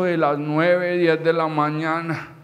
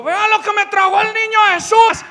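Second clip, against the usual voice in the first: high, loud theatrical yell; hammed-up furious shout; yelling from rooftop; 7 dB louder than usual. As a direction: proclaim it bitterly to the whole street. Showing coal from the rooftop.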